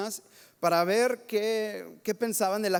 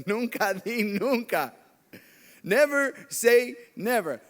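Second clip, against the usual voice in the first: slow, louder, spaced out; emphatic maxim; 3 dB louder, slower than usual. Real, not acted. Pastor driving home his point to the congregation, slow and emphatic. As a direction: land the lesson word by word.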